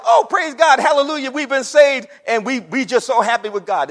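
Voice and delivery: high, loud hallelujah outburst; exaggerated, loud rejoicing; mock joyful praise. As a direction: act out a jubilant crowd praising God. Voicing imagined grateful Israelites praising God.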